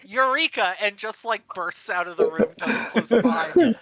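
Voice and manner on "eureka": loud, raised cry of discovery; higher, louder mock inventor's shout; excited yell. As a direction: yell it out like a sudden brainwave. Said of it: "Nico just yells out".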